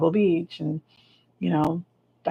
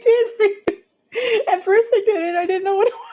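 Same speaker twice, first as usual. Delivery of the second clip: very high squeal through laughter; laughing to tears. Real, not acted. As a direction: try to explain while helplessly giggling. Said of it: "they're making me cry".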